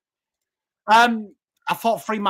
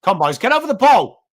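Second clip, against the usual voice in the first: urging shout; 4 semitones higher, 7 dB louder, faster than usual. Real, not acted. Urging players while watching.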